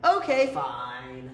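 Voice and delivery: teacher mock-reluctantly giving in to the series; grudging okay fine. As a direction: give in with a heavy exaggerated sigh. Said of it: "I'm like".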